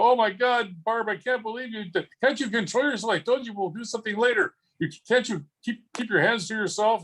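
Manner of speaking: high, theatrical fake outrage; mock scandalized. Husband teasing his wife in public, mock outrage. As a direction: pretend she's the one being naughty. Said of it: "of people and go".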